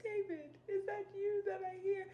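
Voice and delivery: high, crying call across the hills; tearful plea. Retold or imitated. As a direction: cry out his name as if near tears. Saul calling out to David, tearful.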